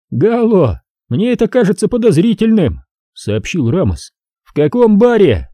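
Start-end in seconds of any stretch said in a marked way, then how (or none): 0.1-2.8 high, needling cop voice; mocking detective, high voice; sly cop Ramos. 4.4-5.5 high, needling cop voice; mocking detective, high voice; sly cop Ramos.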